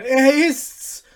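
high loud shout of the grooms; chorus cry. A narrator's reading. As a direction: cry out together in triumph. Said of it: "rief der Chor der Stallknechte".

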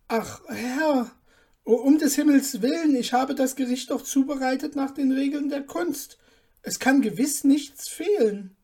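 a terrified little cook begging his master; scared and desperate; trembling dwarf pleading. Acted. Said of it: "So sprach der Zwerg und zitterte".

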